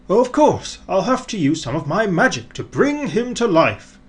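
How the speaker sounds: light up with the idea; sudden bright idea. A narrator's reading. Father Christmas thinking of his magic, excited. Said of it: "thought Father Christmas to himself".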